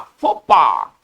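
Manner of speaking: फोपा said as one word with a falling close; French pronunciation. Shown as a demonstration. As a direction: give the finished फोपा. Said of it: "लेकिन पढ़ेंगे फो". (फोपा पूरा बोलकर, गिरते सुर में)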